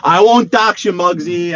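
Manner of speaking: playful loud promise; loud teasing. Pretend. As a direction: call it out to tease Muggsy. Teasing viewer Muggsy loudly.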